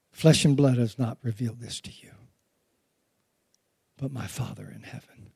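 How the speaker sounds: soft, low whisper, smiling; tender whisper. Retold or imitated. Preacher voicing Jesus answering Peter, whispered with a smile.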